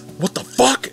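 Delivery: high startled what the fuck; retelling a shocked reaction on Skype; shocked yelp. Retold or imitated. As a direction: jump up in pitch, caught off guard. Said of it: "all my friends heard me say was".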